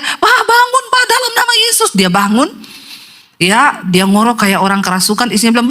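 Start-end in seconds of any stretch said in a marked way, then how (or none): 0.0-1.9 high, urgent cry without extra volume; wife's mock yell. Wife to collapsed husband at home, high imitated yelling. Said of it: "Istrinya teriak".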